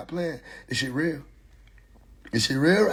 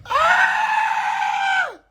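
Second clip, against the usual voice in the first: shriek. Retold or imitated. Shriek after quote.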